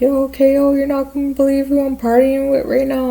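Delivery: high, excited mock party caller; excited phone call. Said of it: "it's all loud, like she's at the club".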